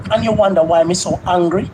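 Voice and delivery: ordinary delivery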